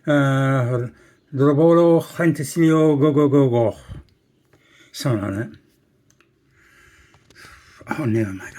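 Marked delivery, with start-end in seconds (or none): 0.1-3.8 loud, higher attempt at a Welsh place name; mock Welsh. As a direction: belt out badly faked Welsh. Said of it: "I wonder if I can say".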